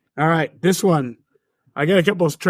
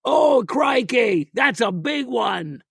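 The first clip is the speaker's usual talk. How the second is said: high, drawn-out Australian shout; Steve Irwin; 7 semitones higher, slower than usual. Shown as a demonstration. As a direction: do an excited Australian, stretching the words. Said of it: "is Steve Irwin, and the phrase is".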